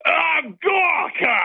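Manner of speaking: high, drawn-out villain's cry; hammed-up evil villain greeting; Sebastian Gorka. Caller posing as Gorka greets the host, evil villain voice. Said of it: "Sounds like an evil villain".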